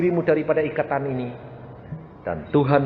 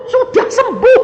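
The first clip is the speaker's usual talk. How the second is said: very high, loud shout ending on a rise; repeated proclamation; 11 dB louder than usual. Retold or imitated. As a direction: echo it upward, triumphant. (mengulang seruan kesembuhan dengan nada naik)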